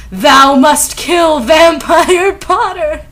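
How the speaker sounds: slow, loud, high theatrical command; Voldemort yelling. A narrator's reading. Villain ordering Ebony to kill, in a theatrical yell. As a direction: proclaim it in grand mock-archaic style. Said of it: "he yelled".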